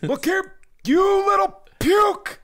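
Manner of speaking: high, loud slow shout; grandpa yelling. Retold or imitated. Grandpa yelling at the grandkids, a loud high shout. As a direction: bellow it like a gruff grandpa. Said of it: "he had names for us".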